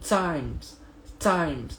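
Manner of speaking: times with a breathy T; slow demo of times; aspirated times. Shown as a demonstration. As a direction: let air out on the T of times. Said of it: "Dia tak sebut time".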